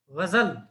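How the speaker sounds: गजल with a throaty gh and a z sound, Urdu style; Urdu ghazal. Shown as a demonstration. Teacher demonstrates the correct Urdu ghazal to students, gh and z. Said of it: "इस तरह से उच्चारण".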